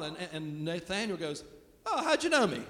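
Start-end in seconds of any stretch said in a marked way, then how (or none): 1.9-2.6 high, surprised 'how'd you know me'; mock surprise. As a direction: play a flattered, surprised Nathanael. Mocking Nathanael's surprise.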